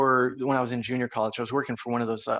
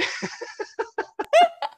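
burst of laughter; 5 dB louder than usual. Real, not acted. Laughing at own story.

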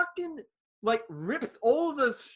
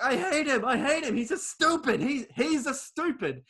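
whiny rant; 6 semitones higher than usual. Retold or imitated. Tom Green ranting quote.

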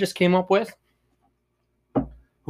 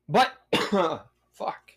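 ordinary delivery

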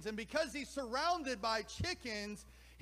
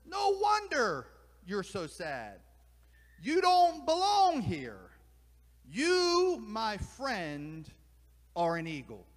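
owl proclaiming; 8 dB louder, slower than usual. Acted. Owl tells the sad bird he is an eagle, loud proclamation. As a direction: declare it like a revelation to a lost friend. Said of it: "The owl says".

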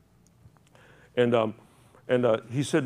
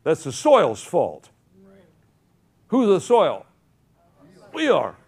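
rising preacher's call, louder and higher; preaching; 6 semitones higher, 6 dB louder than usual. Acted. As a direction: whip up the congregation. Preacher rallying congregation.

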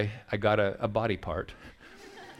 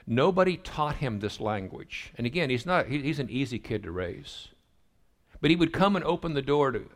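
ordinary delivery

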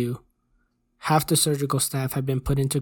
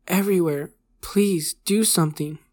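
pained, higher-pitched plea; begging; 4 semitones higher than usual. A narrator's reading. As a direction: beg for relief from the pain. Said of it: "he begged".

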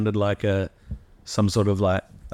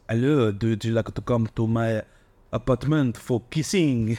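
mock-seductive French-accented English; hammed-up French lover's accent; sexy French accent. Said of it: "sexy way of pronouncing things".

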